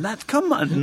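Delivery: squeaky hammed-up outrage; mock outrage. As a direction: play him losing it over a cup. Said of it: "he went mental".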